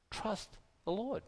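hushed, gentle, rising at the end; quiet heartfelt urging; soft plea. Real, not acted. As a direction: say it softly, like a tender encouragement. Softly urging listeners to trust.

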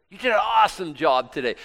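loud, high cheer of approval; enthusiastic praise. Acted. The Lord visiting Paul in prison, loud enthusiastic praise. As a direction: burst out congratulating him. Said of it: "he shows up to Paul in prison".

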